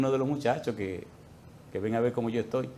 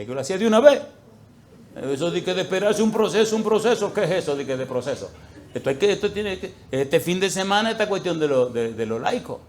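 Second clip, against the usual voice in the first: indignant complaint; 5 semitones higher, 5 dB louder than usual. Retold or imitated. Complaining argument with God.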